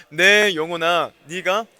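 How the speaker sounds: loud, slow reading cue to the congregation; slow call-and-response reading. Shown as a demonstration. Preacher starts the congregation's reading, loud and slow. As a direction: lead the church into reading aloud. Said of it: "같이 한번 읽어보도록".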